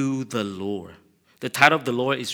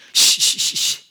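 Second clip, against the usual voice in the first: hushing shh; 9 dB louder than usual. Acted. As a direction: hush him gently, breath only. Father hushing the son.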